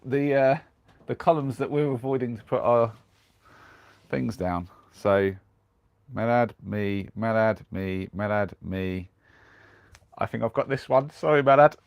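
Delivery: normal talking voice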